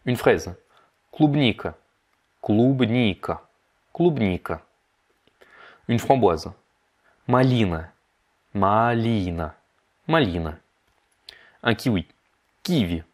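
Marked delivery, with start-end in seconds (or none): 2.4-4.6 "клубника" drawn out syllable by syllable; slowed-down model. Shown as a demonstration. Teacher breaks down the Russian for strawberry, very slow. 8.5-10.6 "малина" drawn out syllable by syllable; slowed-down model. Shown as a demonstration. Teacher breaks down the Russian for raspberry, very slow.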